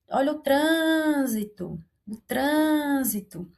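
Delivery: "trânsito" with a long, closed, nasal "trân"; stressed first syllable. Shown as a demonstration. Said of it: "aí ele tem um acento mais fechado".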